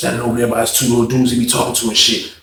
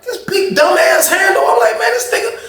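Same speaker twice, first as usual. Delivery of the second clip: retold exasperation. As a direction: squeak it out, acting incredulous. Acted disbelief at huge handle.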